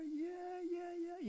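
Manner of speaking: high mock cheer of thrilled kids; preacher mimicking the kids' high cheers. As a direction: squeal it like kids told they're going to Disney. Kids cheering about Disney.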